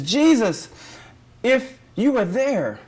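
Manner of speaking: high, loud cry to Jesus; anguished appeal. Acted. Mary reaching Jesus after her brother's death, anguished appeal. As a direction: plead it high and drawn out. Grieving Martha's sister reproaches Jesus.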